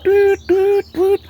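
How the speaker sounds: very high voiced whistle toots; imitated police whistle. Policeman raising the alarm, high imitated whistle toots. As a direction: mimic sharp whistle blasts with the voice. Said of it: "a policeman hits the whistle".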